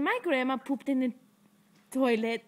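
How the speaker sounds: usual speaking voice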